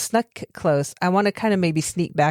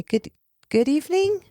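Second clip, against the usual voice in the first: wrong voice; 11 semitones higher than usual. Acted. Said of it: "that's not how I talk".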